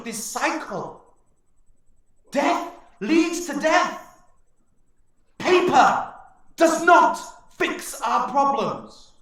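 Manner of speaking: high, loud, slow solemn plea; elder orc rebuking Garrosh before the tribe; old orc pleading. Acted. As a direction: urge the chief with grave conviction. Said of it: "turns to him and says".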